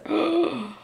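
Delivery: high gasp of delight; sharp thrilled intake of breath; awed gasp; excited gasp. Real, not acted. Thrilled by the blanket's colours.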